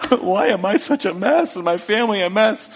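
gasp, then lament like a comic wreck; exaggerated woe-is-me cry; mock wail. Acted envious wail after a gasp.

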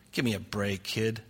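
low, muttered mock scorn; quiet, low dismissive aside; dismissive scoff. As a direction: brush him off under your breath. Scoffing reply to Jesus' answer.

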